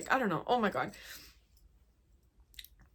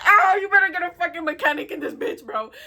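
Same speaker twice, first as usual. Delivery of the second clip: higher, louder retold outburst; sassy, fed-up demand; mock angry demand; 7 semitones higher, 9 dB louder than usual. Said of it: "I was like".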